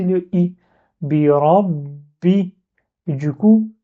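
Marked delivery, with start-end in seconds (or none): 1.0-2.5 "Birabi" very slowly, the last b held shut before the i bursts out; blocked ba. Shown as a demonstration. Teacher shows something at the end, "Birabi" with a blocked b. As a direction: double the b in "Birabi", stopping on it as in rabbi. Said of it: "comme si je bloque le ba avant de prononcer".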